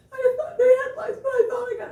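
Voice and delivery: very high, loud, laughing-sob wail; mock crying client. Client realizing her kids gave her lice, high tearful wail.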